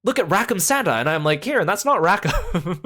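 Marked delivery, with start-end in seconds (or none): 0.0-0.9 high-pitched imitation of naive amazement; friend's wonder. Friend mistaking Santa for Rackham, imitated wonder. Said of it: "Kieran turns to me and goes like". 1.4-2.8 higher, slow correction breaking into a laugh; amused correction. Retold or imitated. Correcting friend who thinks it's Rackham, mock exasperated.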